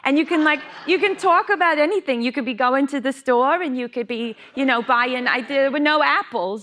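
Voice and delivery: high, chatty New York accent. Shown as a demonstration. Actress shows friends' running joke, high New York accent. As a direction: prattle about shopping in a New York accent. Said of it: "a New York accent".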